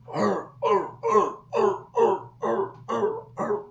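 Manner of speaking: high, repeated hound barks; short high yelps, evenly repeated; imitated dog bark. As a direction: bark like a coon dog at a tree. Imitates the dog barking at tree.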